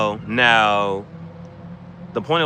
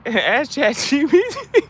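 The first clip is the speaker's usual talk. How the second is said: mock voice; 12 semitones higher, 4 dB louder, slower than usual. Mock suggestion, laughing.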